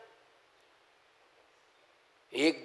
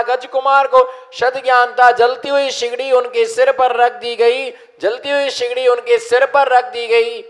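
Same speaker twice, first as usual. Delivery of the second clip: preaching; 3 semitones higher, 7 dB louder than usual. Acted. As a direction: drive each phrase home to the congregation. Preaches with call and response.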